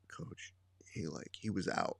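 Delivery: whispered under the breath; hushed aside. Retold or imitated. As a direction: sneak the words to the coach. Student signalling the coach quietly.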